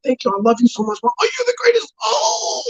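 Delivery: gushing climbing into a high squeal; hammed-up joy, pitch soaring to the top; elated and happy. Son thanking his mother for sneakers, squealing with acted elation. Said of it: "He's so elated and happy".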